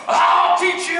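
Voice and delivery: high, loud instructor threat; imitated yelling. Drill instructor to clueless cadet, high mock yell. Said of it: "he starts yelling at me".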